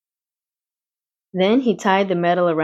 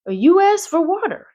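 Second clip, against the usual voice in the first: ordinary delivery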